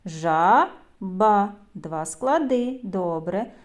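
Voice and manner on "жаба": "Жаба" split into two beats, Жа and ба; syllable by syllable. Shown as a demonstration. Teacher counting syllables with children, "Жаба" syllable by syllable. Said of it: "Порахуйте".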